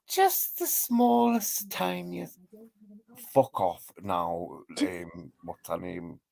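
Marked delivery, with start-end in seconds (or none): 0.1-2.4 high, slow little voice for the smallest thing; mock tiny-voiced aside. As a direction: squeak it out small.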